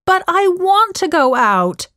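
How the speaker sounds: put-on high-pitched, protesting teenage shout; shouting back. A narrator's reading. Daughter argues with mom about going out, shouting back. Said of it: "Joe shouted back".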